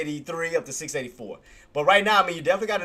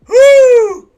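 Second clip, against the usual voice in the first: loud, high-pitched "woo"; excited whoop; 18 dB louder than usual. Real, not acted. Trader celebrating a crazy spread, excited whoop.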